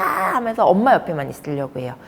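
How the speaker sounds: ordinary talk, nothing marked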